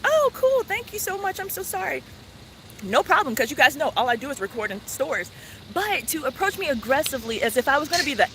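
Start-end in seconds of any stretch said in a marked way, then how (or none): 0.0-2.0 much higher, sweet and thankful; bright grateful reply. Retold or imitated. A customer thanking a polite clerk, high and sweet. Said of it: "I'll be like".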